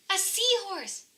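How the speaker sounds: high, thrilled exclamation; excited cry. A narrator's reading. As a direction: Pete spotting the seahorse at last, a high excited cry.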